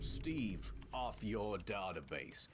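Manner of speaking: drawn-out heroic line; slow dramatic. Acted. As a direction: stretch it slowly like a hero. Superhero ad slow.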